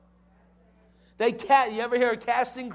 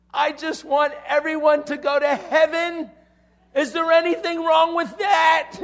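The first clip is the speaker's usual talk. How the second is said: reenacted shout, high and loud; top of the voice; 6 semitones higher, 5 dB louder than usual. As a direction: blurt it out as a full-voiced retort, building louder. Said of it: "responded at the top of my voice".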